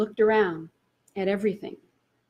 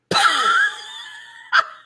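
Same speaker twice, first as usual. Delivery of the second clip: long high helpless laugh; high laugh in place of an answer; laughter burst; 8 dB louder than usual. Real, not acted.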